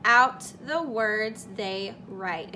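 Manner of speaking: very slow, stretched words; reading the rule slowly, stretching every word. Shown as a demonstration. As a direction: draw each word out like a rubber band. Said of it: "like a rubber band and stretching it out".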